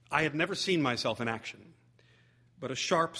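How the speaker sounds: ordinary delivery, nothing marked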